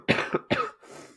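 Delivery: wet, phlegmy cough; real chesty cough. Real, not acted. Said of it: "So I got that phlegmy cough now".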